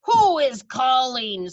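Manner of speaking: slow, loud sharp snap; snapped. Acted. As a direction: bite the words off angrily. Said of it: "snapped the Grand High Witch".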